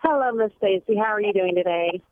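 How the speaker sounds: normal talking voice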